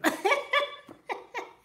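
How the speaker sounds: high burst of laughter; high, delighted peals of laughter; real cackling laugh. Real, not acted. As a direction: cackle at your own joke. Laughs at her own impression.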